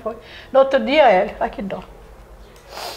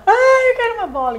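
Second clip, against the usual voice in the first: imitated crying child; 11 semitones higher than usual. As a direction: mimic a kid crying for a toy. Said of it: "o menino chorava". (imitando menino chorando, voz bem aguda)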